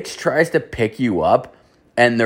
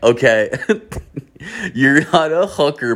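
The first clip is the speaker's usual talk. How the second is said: laughing mid-line, pitch jumping higher; 6 semitones higher than usual. Real, not acted. Guy laughing at woman insisting she's an escort. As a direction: crack up while repeating her words.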